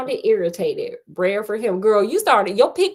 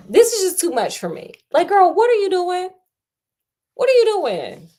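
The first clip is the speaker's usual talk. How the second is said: much higher, pleading disbelief; what are you doing, higher each time; incredulous; 9 semitones higher, 4 dB louder than usual. Real, not acted. Host baffled by the heroine, high and incredulous.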